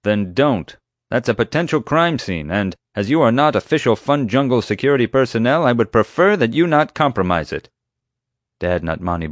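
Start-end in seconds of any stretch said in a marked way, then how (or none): 0.0-7.7 higher, loud official warning; officious security chief. A narrator's reading. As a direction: lay down the rules with authority. Chief forbids entry by radio.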